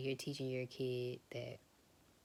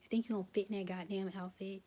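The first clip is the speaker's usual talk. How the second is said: higher, louder, fast mocking jab; mock mom berating; 7 semitones higher, 5 dB louder than usual. Mother mocking her nine-year-old son's body, loud and fast. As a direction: belittle the kid in an imitated mom voice. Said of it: "or telling their son".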